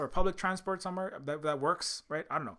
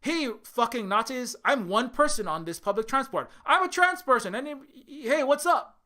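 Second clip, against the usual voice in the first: mock cheerful call-out; 6 semitones higher than usual. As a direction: hail the gang like old friends. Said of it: "you're not gonna go".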